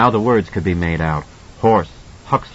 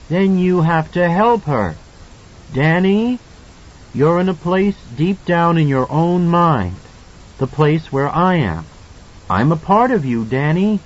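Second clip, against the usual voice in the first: Tony voice; 6 semitones higher than usual. A narrator's reading. Tony's calm guiding voice.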